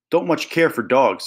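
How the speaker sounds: plain reading voice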